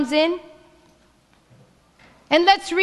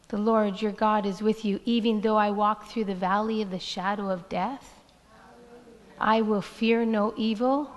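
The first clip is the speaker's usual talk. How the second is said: softer, hushed reading of the psalm; soft recitation; 5 semitones lower, 5 dB quieter than usual. Acted. Pastor quietly reciting Psalm twenty-three to congregation, hushed. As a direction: let the verses settle gently, almost prayed.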